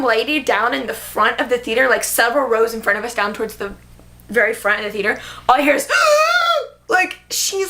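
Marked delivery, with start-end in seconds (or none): 5.9-6.7 high, loud mock sob-gasp; imitated loud bawling. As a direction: mimic a stranger's full-out wail. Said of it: "full out bawling".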